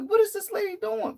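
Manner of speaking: can't believe what you're seeing; shocked disbelief. Retold or imitated. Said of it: "I was like".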